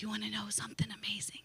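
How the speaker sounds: soft secret whisper, quick. Real, not acted. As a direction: lean in and breathe the secret. Secretly telling husband about pregnancy.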